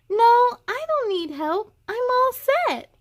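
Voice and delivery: put-on high, proud toddler voice; little boy, pleased with himself. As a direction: say it like a kid showing off.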